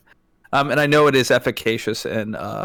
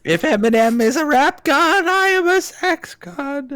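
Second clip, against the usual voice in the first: hammy boast. Pretend. Tormenting co-host with the bit, hammy high boast. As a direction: belt it out high and ridiculous.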